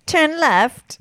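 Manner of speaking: high-pitched mock-British GPS; British navigation voice. Correcting the impression of the maps app, British voice. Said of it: "It's more like".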